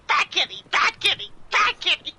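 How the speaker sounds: high, loud mock battle cry; imitating brother shouting. Brother punching the jaguar, high loud hammed-up shouting. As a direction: chant it like a silly fighter. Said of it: "He's like".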